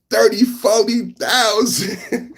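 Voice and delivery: high, drawn-out, breaking into laughter; laughing punchline. Acted. Streamer quoting a favorite bit to chat, laughing. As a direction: stretch the punchline slowly till laughter takes over.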